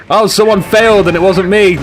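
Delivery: acted glee at another's mishap; gleeful British onlooker. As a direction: gloat like a spectator at someone's failure. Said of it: "we all like to cheer, we all like failure".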